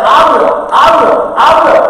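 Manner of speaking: shouted, rising "I will"; proclaiming shout. Retold or imitated. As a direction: declare God's promise triumphantly. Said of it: "But the new covenant says".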